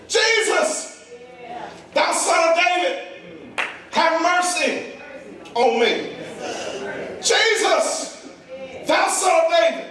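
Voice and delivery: high, ringing cry in separate bursts; high, drawn-out plea; hollering at full lung. Acted. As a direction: cry out for mercy, settling on the last words. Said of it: "hollers to the top of his lungs".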